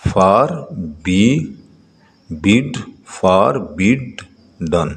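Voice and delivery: فار، بڈ، ڈن each set apart by a pause; teacher breaking فار بڈ ڈن into pieces; syllable by syllable. Shown as a demonstration. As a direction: sound out فار بڈ ڈن slowly, one syllable at a time. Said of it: "Read the following multi-syllable words".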